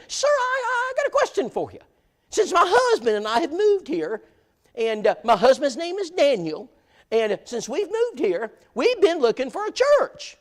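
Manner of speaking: high falsetto imitation of an excited lady; mock high woman's voice. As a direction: squeak it out like a delighted Southern lady. Brenda asking about a church.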